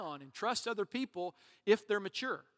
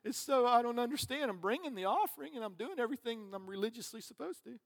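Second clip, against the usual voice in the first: higher-pitched mock wailing complaint; whining; 4 semitones higher than usual. Preacher imitating weeping worshippers pleading with the Lord, whiny. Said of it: "That was just me whining".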